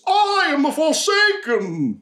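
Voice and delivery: high, slow, grand proclamation; booming champion. Acted. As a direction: announce yourself like a hammy arena champion. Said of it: "throws his arms to the air, and goes".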